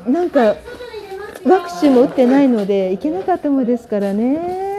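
ordinary talk, nothing marked

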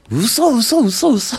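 high, rapid "嘘" repeated in shock; stunned, high-pitched denial; disbelief. Retold or imitated. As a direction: say it like you cannot believe your eyes. Performers reacting to the blunder.

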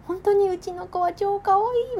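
high, loud doting cutesy voice; talking to a baby. Retold or imitated. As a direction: gush over the kitten like a baby. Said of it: "みたいなね".

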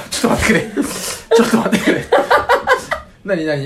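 high, helpless laughing plea; laughing protest. Real, not acted. Host cracks up at the guest's revelation, laughing protest. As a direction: beg for a pause through laughter.

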